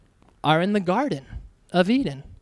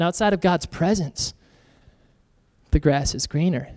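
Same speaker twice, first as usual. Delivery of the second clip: low, lightly voiced tempter; understated, insinuating pitch of a lie; tempting liar; 8 semitones lower than usual. The lie introduced to Adam and Eve, lightly voiced tempter. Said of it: "a lie is introduced".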